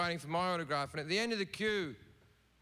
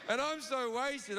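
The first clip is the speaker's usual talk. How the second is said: usual speaking voice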